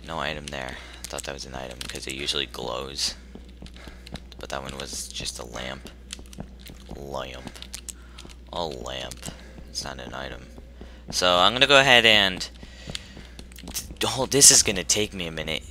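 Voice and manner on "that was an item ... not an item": whispered aside; hushed mutter to self. Real, not acted. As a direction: breathe it out, disappointed. Quiet disappointed aside.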